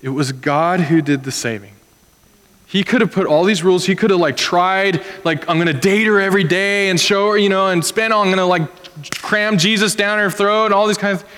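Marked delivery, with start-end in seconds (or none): 2.7-11.3 high-pitched, worked-up listing; mock overzealous rant. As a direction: imitate a parent trying far too hard. Imagines an overzealous father's efforts.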